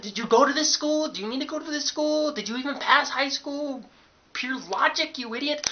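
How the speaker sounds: loud, high-pitched incredulous tirade; exasperated rant. Acted. Student berating the clueless clerk, exasperated rant. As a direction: hurl each question in rising outrage.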